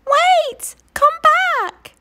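high call after the running mammoth. Acted. Little Lou calling to the mammoth, high call. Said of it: "called Little Lou".

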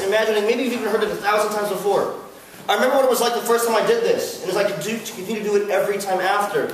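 normal talking voice

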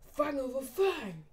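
acted anime attack call. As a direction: call the move's name slowly and high. Character calls out an attack.

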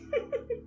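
tearful high giggle. A narrator's reading. Sprite giggling at the narrator. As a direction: keep giggling. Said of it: "kichert weiter".

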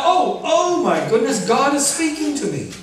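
high-pitched astonished exclamation; mock amazed realisation. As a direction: act out suddenly catching on. Acts out finally hearing God.

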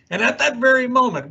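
plain reading voice

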